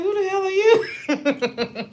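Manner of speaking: high, laughing, slow mock outrage; cracking up while asking; laughing disbelief. Looking down at the dream boy, laughing in disbelief. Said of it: "I'm looking down like".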